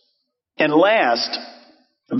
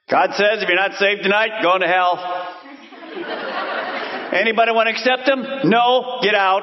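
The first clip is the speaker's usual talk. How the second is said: harsh prophet; 5 semitones higher than usual. Pretend. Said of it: "See, that's pure prophet".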